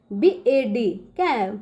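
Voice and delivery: ordinary talk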